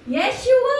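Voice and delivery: high, loud shout of the name; shouted praise. Acted. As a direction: cry it out like a war shout. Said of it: "alam ko sinyout nila".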